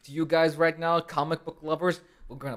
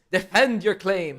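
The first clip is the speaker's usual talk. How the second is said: loud, slow proclamation; drawn-out, booming mock demand; mock challenge; 4 semitones higher, 9 dB louder, slower than usual. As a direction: throw down a playful gauntlet, loudly. Mock challenge to comic book lovers.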